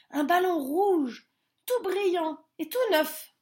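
proud of their ball; excited rabbits. A narrator's reading. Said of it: "répondirent les lapins".

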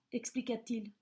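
ordinary delivery

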